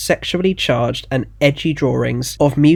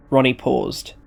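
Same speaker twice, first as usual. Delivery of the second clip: ordinary delivery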